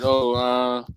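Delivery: usual speaking voice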